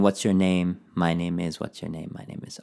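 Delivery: low, quick, quiet run-through; sped up. Shown as a demonstration. Teacher showing the speed-up game, quick. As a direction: rattle off question and answer, fading away. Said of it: "get them to speed up".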